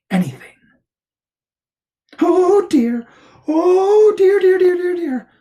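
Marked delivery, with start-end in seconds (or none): 2.2-5.3 high, loud squeal of dismay; high-voiced Piglet in a fluster; anxious Piglet. A narrator's reading. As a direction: fret as a little piglet, pitched way up. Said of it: "said Piglet to himself".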